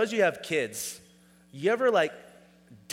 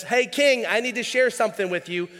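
soldier calling out; 7 semitones higher, 5 dB louder than usual. Retold or imitated. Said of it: "this soldier says".

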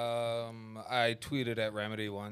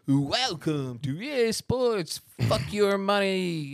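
slow, higher announcer voice, mocking; mock game announcer; 8 semitones higher, 5 dB louder, much slower than usual. Mocking the EA Sports intro as a slow announcer. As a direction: drawl it like a cheesy EA intro. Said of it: "and then it's like".

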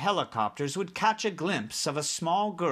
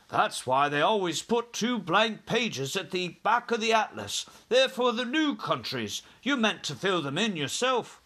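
put-on higher, cheery young pilot's voice; grinning pilot; 6 semitones higher than usual. A narrator's reading. Young pilot to the two chiefs, grinning explanation. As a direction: explain it with a broad grin. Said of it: "was still grinning broadly".

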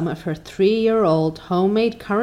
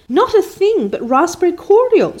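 put-on higher and louder girl's sob; sobbing; 11 semitones higher, 4 dB louder than usual. A narrator's reading. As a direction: cry the words out in despair. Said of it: "sobbed Anne".

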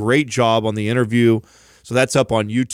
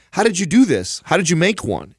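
higher-pitched, eager retold questions; amazed questioning; 7 semitones higher than usual. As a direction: replay your wide-eyed curiosity. Retelling his excited questions.

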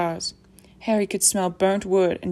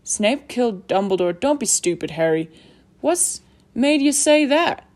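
indignant big-man voice, louder at the end; scolding disbelief; 4 semitones higher, 5 dB louder than usual. A narrator's reading. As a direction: rebuke building to a high, loud question. Hagrid scolds Harry in disbelief.

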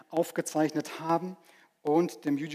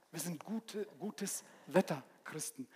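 quiet repeat; 14 dB quieter than usual. Shown as a demonstration. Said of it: "quiet repeat of phrase".

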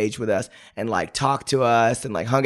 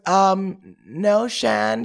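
[name]; 8 semitones higher, much slower than usual. Retold or imitated. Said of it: "And she goes".

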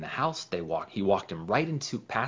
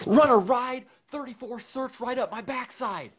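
yelled play call, much higher and louder; fired-up play call; 9 dB louder than usual. Acted. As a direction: belt out the play like pure adrenaline. Inner voice in the huddle.

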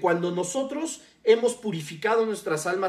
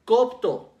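copto repeated with a louder first syllable; stress on first O; 3 semitones higher, 5 dB louder than usual. Shown as a demonstration. Preacher repeating the Greek root, copto stressed on first O. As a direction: punch the first O of copto, then fall. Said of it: "con acento en la primera O".

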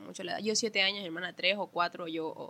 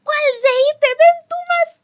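much higher, loud scolding yell; angry mom shout; 19 dB louder, much slower than usual. Retold or imitated. Mom yelling at the daughter hiding from the spanking. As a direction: bellow it like a furious mother mid-chase. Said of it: "mi mamá".

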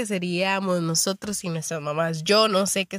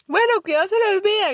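high, louder motherly caution; woman caller warning; 11 semitones higher, 5 dB louder, faster than usual. Retold or imitated. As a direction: warn like a mom who worries.

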